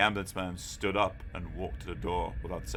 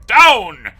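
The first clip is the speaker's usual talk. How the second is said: "down!" yelled much louder and higher; yelled command; 12 semitones higher, 19 dB louder than usual. A narrator's reading. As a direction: bark it at full force. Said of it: "a loud command".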